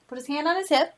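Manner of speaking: ordinary delivery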